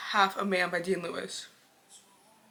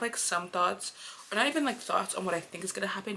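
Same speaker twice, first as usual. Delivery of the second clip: usual speaking voice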